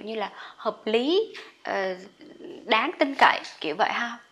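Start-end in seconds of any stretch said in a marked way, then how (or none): none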